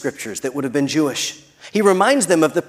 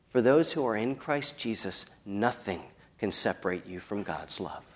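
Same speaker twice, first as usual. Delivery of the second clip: very soft, low closing words; hushed assurance; 7 semitones lower, 12 dB quieter than usual. Real, not acted. Preacher assuring believers of God's love, hushed. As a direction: drop right down, letting it settle quietly.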